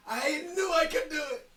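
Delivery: loud, high cry of triumph; triumphant shout. Real, not acted. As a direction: yell it out in victory. Player triumphs in game.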